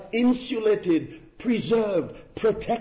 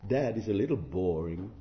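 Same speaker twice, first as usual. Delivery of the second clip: lightly voiced bored child, low and soft; a hint of low-key boredom; bored children; 7 semitones lower, 4 dB quieter than usual. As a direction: imitate children complaining, pitched down and subdued. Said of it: "Probably they're saying".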